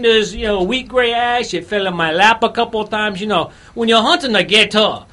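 hammed-up Louisiana bayou accent; put-on Cajun accent. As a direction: play a Cajun gator hunter, accent laid on thick. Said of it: "I still don't know whether it's Cajun".

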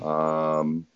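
soft, deadpan clerk voice; imitating the clerk. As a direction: drop the news flatly and quietly. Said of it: "And he goes".

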